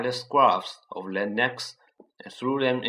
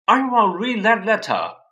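put-on loud, higher child's demand; stubborn, shouted insistence; said loudly; 10 semitones higher, 9 dB louder than usual. A narrator's reading. Dudley insists to his parents, loud and high. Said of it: "he said loudly".

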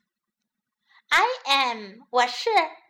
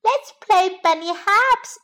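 put-on high, loud, eager child voice; excited little bunny; 8 semitones higher, 8 dB louder than usual. A narrator's reading. Little bunny proposing a game to Mummy, excited high pipe. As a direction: burst out, keen to play. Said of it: "jumped up excitedly".

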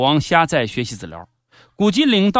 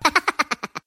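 short laugh; 9 semitones higher, 3 dB quieter than usual. Real, not acted. Host laughs at own joke.

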